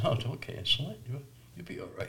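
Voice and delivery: soft, hushed reassurance fading to a whisper; gentle voice of the Lord. Retold or imitated. The Lord telling the preacher not to cancel, whispered. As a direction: whisper it like a comfort from God. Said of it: "And the Lord says".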